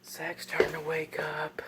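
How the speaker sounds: slow hushed whisper. Real, not acted. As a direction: keep it down, he's sleeping. Whispering while Zach sleeps.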